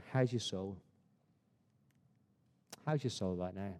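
hushed and low, gently probing; intimate, softened pastoral question; soft, searching question. Real, not acted. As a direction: ask it very quietly, letting it sink in. Soft closing question to congregation.

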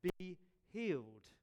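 slow and loud, drawing out "healed"; a loud, slow healing command. Retold or imitated. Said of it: "I expect Jesus to say".

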